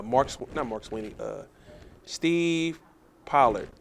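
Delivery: ordinary delivery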